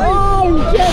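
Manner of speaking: loud startled curse; shouting. Real, not acted. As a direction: yell it out in shock. On the ride.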